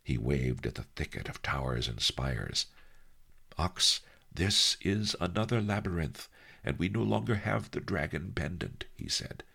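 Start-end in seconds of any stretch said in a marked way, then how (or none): 3.6-8.9 higher-pitched voice of a scheming sage; Master Lee, musing. Acted. Master reasons out the labyrinth to his helper, musing. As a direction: think aloud in a high old master's voice.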